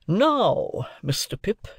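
high, emphatic opening word; commanding lawyer. A narrator's reading. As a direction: call the ward to attention. Lawyer calls ward to attention.

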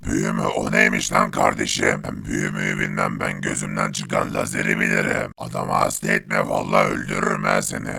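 a tough robot with no tune in the voice; gruff toneless robot. Acted. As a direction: speak like a machine, threatening.

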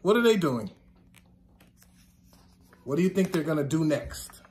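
ordinary talk

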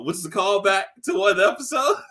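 normal talking voice

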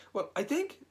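high, nervous start. A narrator's reading. Piglet speaks up to Eeyore, high and nervous. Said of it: "said Piglet nervously".

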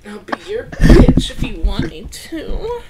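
flirty. Pretend. Teasing flirt after repeated insults.

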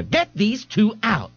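high, slow, sharp order; stern bar owner. Acted. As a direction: bark the order at them. Miss Lee ordering them out.

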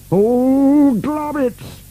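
very high-pitched dismayed cry; shouting. A narrator's reading. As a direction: yell it out in high-pitched alarm. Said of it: "shouted Burke".

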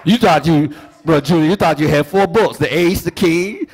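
high, loud sung preaching over music; preacher whooping at full force. Acted.

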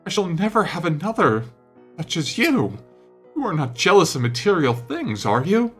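high, urgent plea; alarmed pleading. A narrator's reading. Painter turns pale at lad's suicide threat, alarmed pleading.

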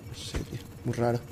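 whispering under breath; whispered mutter. Real, not acted. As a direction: breathe it out, baffled, to yourself. Muttering to himself, puzzled.

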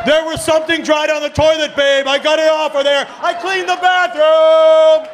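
high, loud mock-triumphant holler; hammed-up shouted brag; proud boasting. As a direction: crow it like a champion, stretching the endings. Proud man boasting to his partner.

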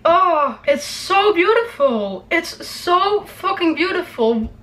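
slow, high and awed; moved. Real, not acted. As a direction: savour each word, overwhelmed. Overwhelmed by the trailer.

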